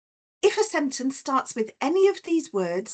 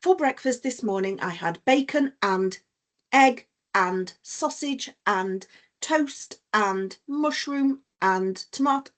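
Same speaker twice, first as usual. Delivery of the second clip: laboured list. Shown as a demonstration. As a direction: plod through the items joined by "and", one by one. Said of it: "I wouldn't say out loud".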